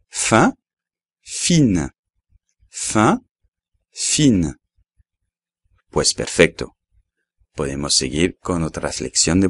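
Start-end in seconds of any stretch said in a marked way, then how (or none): 0.1-4.6 fin with nasal vowel, no n; nasal fin repeated slowly; French fin. Shown as a demonstration. Teacher contrasting fin for students. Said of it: "ves bien la diferencia".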